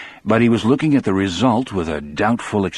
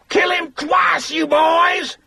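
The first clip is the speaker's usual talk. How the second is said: put-on a shrieking, much higher scream from the old mother; slow, piercing scream of hate; slower than usual. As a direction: howl it at the guards from the crowd. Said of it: "Marjorie Deterick suddenly screamed".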